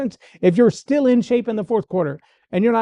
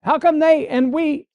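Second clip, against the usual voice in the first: crowd yell imitation; 9 semitones higher, slower than usual. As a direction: shout like one of many angry voices. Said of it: "fifty people are yelling out".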